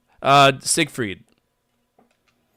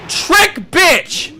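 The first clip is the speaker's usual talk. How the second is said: loud high taunting yell; excited shout; 10 dB louder than usual. Real, not acted. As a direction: crow the taunt at full volume. Gamer taunting in fight.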